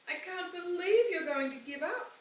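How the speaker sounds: put-on a child protesting loudly to her father; upset protest. A narrator's reading.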